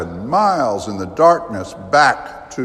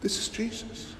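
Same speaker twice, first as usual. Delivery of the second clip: breathy hush, pitch up; preacher whispering for emphasis; whispered emphasis; 11 semitones higher, 14 dB quieter than usual. Acted. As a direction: lean in and whisper it. Preacher stresses Jesus' humanity.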